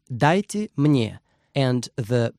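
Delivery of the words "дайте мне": slow model phrase. Shown as a demonstration. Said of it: "Give me".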